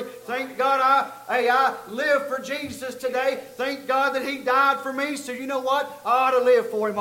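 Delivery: fervent sermon delivery; full-force preaching. Acted. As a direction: drive the point home to the congregation.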